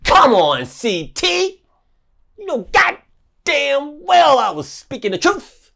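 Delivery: high, slow, mocking call to CT; taunting call-out. Real, not acted. Calling out Carl Thomas in a high slow taunt. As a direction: stretch it out high to needle him.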